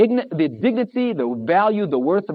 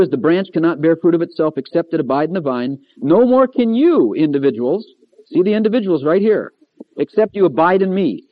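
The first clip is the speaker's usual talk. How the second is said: a preacher booming the words of Jesus; preaching at full force; 5 dB louder than usual. Acted. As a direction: thunder the verse out to the congregation.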